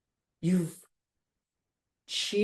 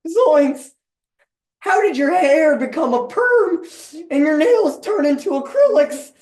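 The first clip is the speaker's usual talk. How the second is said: higher, louder startled Shaggy; 7 semitones higher, 5 dB louder than usual. Acted. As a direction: squeak it like a panicked Shaggy. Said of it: "To which Shaggy goes".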